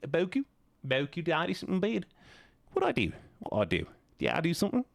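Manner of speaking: questioning New Zealand lilt; New Zealand accent. Shown as a demonstration. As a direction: keep asking in a put-on New Zealand accent. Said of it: "they always ask questions at the end".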